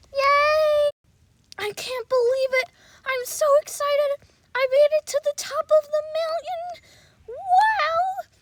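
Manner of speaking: high, loud whoop of triumph; cheering. Acted. Young explorer reaching the mountain top, cheering high and loud. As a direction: cheer at the summit. Celebrating at the summit.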